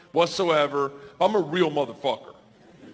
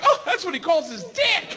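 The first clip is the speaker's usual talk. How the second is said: blurting shout. Retold or imitated. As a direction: blurt it out cheerfully. Wife blurts the secret.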